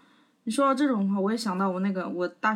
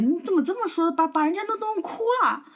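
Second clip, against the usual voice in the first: higher, accusing "how could you say that"; imitating reproachful dormmates; 8 semitones higher than usual. Neighbours blaming narrator for making roommate cry, higher reproachful tone. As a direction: play the nosy neighbour scolding, pitched up.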